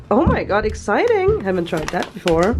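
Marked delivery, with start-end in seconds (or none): 0.1-1.4 excited. Real, not acted. Delight at pak choi.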